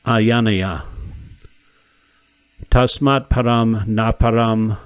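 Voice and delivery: slowing toward the end, measured chant; slow devotional intoning; Sanskrit verse recitation. Acted. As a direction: let the verse slow and settle as it ends. Quotes Sanskrit Upanishad verse.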